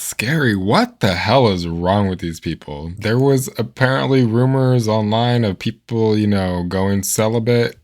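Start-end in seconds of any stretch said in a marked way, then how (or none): none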